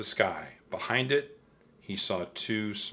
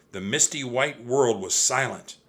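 ordinary delivery

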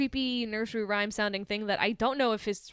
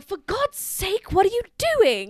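high, loud hammed-up outburst; exasperated scolding; 10 semitones higher, 8 dB louder than usual. Imitating a fed-up wife scolding Bill, exasperated. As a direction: wail it in mock outrage, hitting DOING hard.

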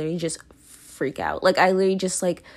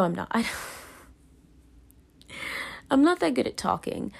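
ordinary delivery

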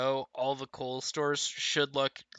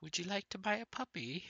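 higher, softer eerie mimic of the man; imitating the creepy stranger; 6 semitones higher, 8 dB quieter than usual. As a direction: parrot him like a creepy broken record.

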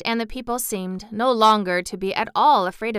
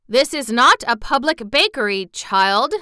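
mildly stern rebuke, louder and higher; 4 semitones higher, 6 dB louder than usual. A narrator's reading. Bun man refusing the begging girl, stern. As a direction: put the child firmly in her place. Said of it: "replied the man sternly".

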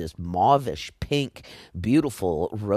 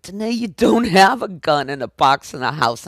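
incredulous; 7 semitones higher, 6 dB louder than usual. Retold or imitated. Chris incredulous, laughing.

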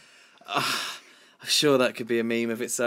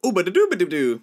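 high, louder sing-song nonsense; silly scat; 7 semitones higher, 6 dB louder, faster than usual. Pretend. Co-host playing the game with nonsense. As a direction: scat it goofily.

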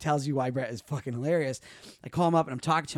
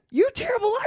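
high teasing; 5 dB louder, faster than usual. Retold or imitated. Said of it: "He's like".

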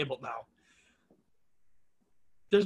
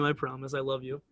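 soft, lower, hammed-up devotion; mock tender; 6 semitones lower, 9 dB quieter than usual. Promising the dead dog mascot his love, soft and mock tender. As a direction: whisper a fond goodbye to the dog.

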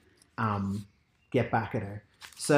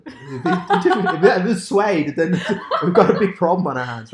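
laughing; 5 semitones higher, 7 dB louder than usual. Real, not acted. Joking about suede, laughing through it.

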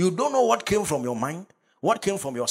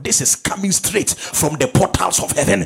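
loud, higher, driving preacher's shout; full-force preaching; 5 semitones higher, 9 dB louder than usual. Real, not acted. Pastor driving scripture home to the congregation, full-force preaching.

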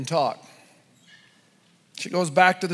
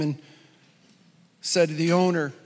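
ordinary delivery

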